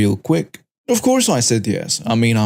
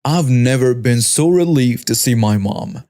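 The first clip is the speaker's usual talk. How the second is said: usual speaking voice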